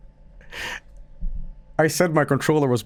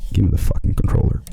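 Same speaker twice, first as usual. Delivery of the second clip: hissed, urgent whisper; snatch it with grim resolve; intense demand; 5 semitones lower, faster than usual. Retold or imitated. Demanding the controller from the kid in a hiss. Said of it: "and I'm like".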